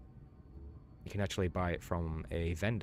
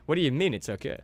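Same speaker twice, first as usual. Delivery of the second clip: high, loud incredulous protest; hammed-up exasperation; mock outrage; 8 semitones higher, 9 dB louder, faster than usual. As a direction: push it up in playful disbelief. Protests at friend's dismissive reply.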